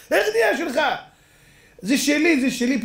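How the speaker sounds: high, insistent protest; indignant claim. Acted. The two men fight over the wagon, high and insistent. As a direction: insist it is yours.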